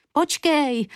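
high call after someone running away. A narrator's reading. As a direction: shout it after her down the street. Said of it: "volala marně Julinka".

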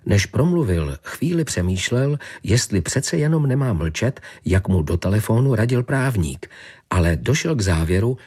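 ordinary delivery, nothing marked

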